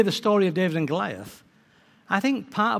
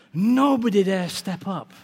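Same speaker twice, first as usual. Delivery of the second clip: ordinary delivery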